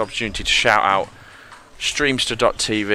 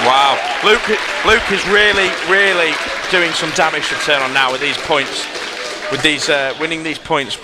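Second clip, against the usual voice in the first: excited; 7 semitones higher than usual. Real, not acted. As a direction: rave about the streak. Commentator reacts to winning streak.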